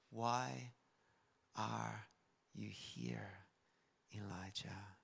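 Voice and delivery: very soft, low, word by word; slow question fading into a whisper; still small voice. Acted. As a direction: ask it in a hush, with long pauses. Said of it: "It's a still small voice".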